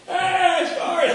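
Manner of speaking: high, loud mock yell down the street. Running down Kedzie Boulevard, yelling high and loud. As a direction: shout it out, half laughing.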